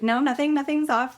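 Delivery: overbright denial. Retold or imitated. Actress hides upset from casting director, overinsistent denial. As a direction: protest too much in a high voice.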